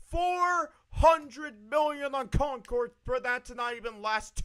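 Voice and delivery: furious streamer rant about layoffs; angry rant. Real, not acted. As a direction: vent in real outrage at the waste. Heated rant about Sony spending.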